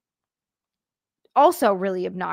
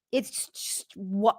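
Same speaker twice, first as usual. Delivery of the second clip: usual speaking voice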